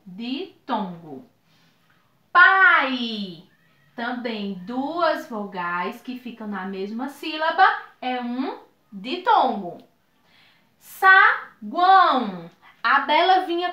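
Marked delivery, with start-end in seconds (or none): 0.0-1.3 "ditongo" repetido devagar e mais baixo; repetição mais baixa. Shown as a demonstration. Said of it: "nós chamamos de ditongo". 10.8-12.5 "Saguão" bem lento e mais alto, sa-guão; palavra silabada. Shown as a demonstration. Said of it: "três vogaizinhas que ficam na mesma sílaba".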